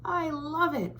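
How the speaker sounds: a hint of a jolly uncle hooting. Uncle Fred hoots his praise.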